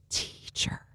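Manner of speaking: a hushed, awed whisper of "Teacher."; whispered recognition. Retold or imitated. Mary turning to Jesus in awe, whispering. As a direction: breathe "Teacher." as if stunned.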